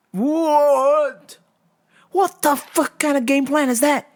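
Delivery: high-pitched, drawn-out disbelief; incredulous. Real, not acted. Host reacting to fighter's game plan, incredulous. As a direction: blurt it high, baffled by the plan.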